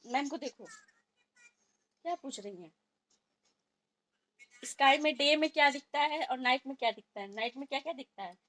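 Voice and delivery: high, loud quizzing voice; prompting like a teacher. Real, not acted. Mother quizzing her son on the teacher's question, high and loud.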